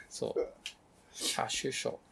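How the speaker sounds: usual speaking voice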